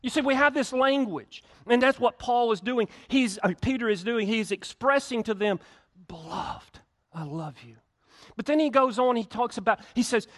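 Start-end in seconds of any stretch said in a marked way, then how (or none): 6.1-7.8 tender affirmation. Retold or imitated. Peter voiced addressing believers.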